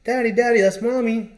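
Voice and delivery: put-on high, excited child's voice; little daughter's voice. Small daughter calling to her dad about her mom, high child voice. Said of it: "and his daughter's like".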